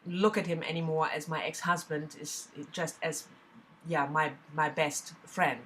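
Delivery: ordinary talk, nothing marked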